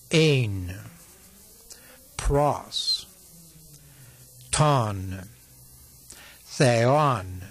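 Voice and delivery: Greek words read one at a time with long pauses. Shown as a demonstration. Tape reads John for students to repeat, slow and spaced. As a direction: pause after each word so the listener can repeat it. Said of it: "read with an interval between each word".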